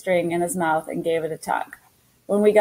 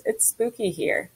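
whiny voice; 3 semitones higher, 6 dB quieter than usual. Acted. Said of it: "in that whiny voice of his".

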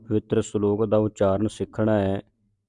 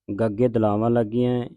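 ordinary talk

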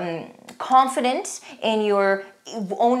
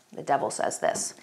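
hushed; 6 semitones lower, 8 dB quieter than usual. Acted. Announcing a devil quote.

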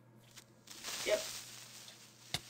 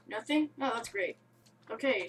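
ordinary talk, nothing marked

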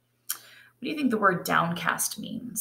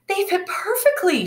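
high, thrilled voice; joyful cry; 13 semitones higher than usual. Acted. Bronterina delights in her new shoes, high and thrilled. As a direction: burst out with delight. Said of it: "she cried".

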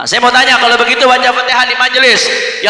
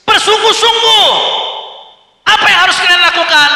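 high, loud exhortation; acted commander's shout; 10 semitones higher, 5 dB louder than usual. Preacher voicing Iblis exhorting his devils, high commanding shout. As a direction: drive the troops on at the top of the voice.

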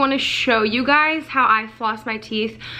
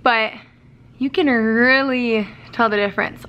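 talking with teeth bared; lips pulled down by fingers. Real, not acted. Seen 2: Both fingers pull lower lip down, teeth bared throughout words.

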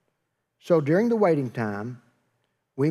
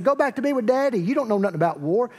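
high-pitched, scornful brotherly scolding; mock angry; 8 semitones higher, 4 dB louder, faster than usual. David's brothers dismissing him from the war, mock angry. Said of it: "his brothers get mad at that".

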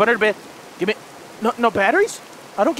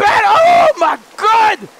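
slow, high, loud cry; real horrified yell; terrified shout; 10 semitones higher, 7 dB louder, much slower than usual. Real, not acted. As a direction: drag out the shout in fear. Sudden scare in game.

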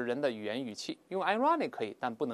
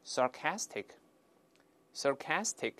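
slow, separated repetitions for learners; read-aloud demo; 4 dB quieter, much slower than usual. Shown as a demonstration. Teacher having learners read the adjective, slow demonstration. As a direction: say it slowly, pausing for the class to echo. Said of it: "我们大声读一下".

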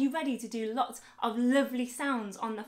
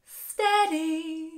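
mock suspense; 4 semitones higher, 6 dB louder than usual. Building suspense for kids.

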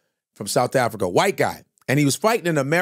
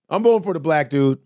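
ordinary talk, nothing marked

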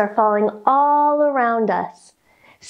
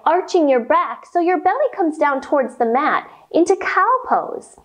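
normal talking voice

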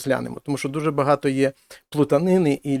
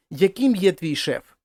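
ordinary talk, nothing marked

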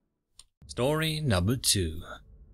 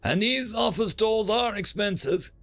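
lightly voiced professor, higher than the narration; a hint of teasing glee, pitched up; gleeful professor; 7 semitones higher than usual. Professor nudging a cowering student out, lightly gleeful.

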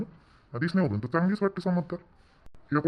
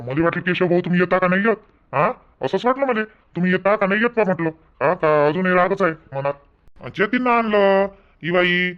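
loud greeting; 4 semitones higher, 7 dB louder than usual. Acted. Loud excited greeting of guest.

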